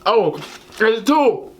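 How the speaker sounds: ordinary talk, nothing marked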